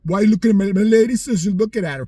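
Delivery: loud confrontational street challenge; mock tough guy. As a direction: get in his face over his girl. Retold guy confronting him.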